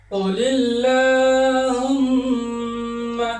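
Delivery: কুলিল্লাহুম্মা chanted very slowly with a light lam; thin lam. Shown as a demonstration. Quran teacher chanting কুলিল্লাহুম্মা slowly with a thin lam. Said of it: "পাতলা করে উচ্চারণ করতে হবে".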